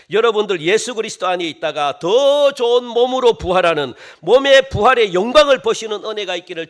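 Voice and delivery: loud, rousing proclamation; full-force preached blessing. Real, not acted. Preacher blessing the congregation with resurrection hope, full-force preaching. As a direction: press the blessing on them with force.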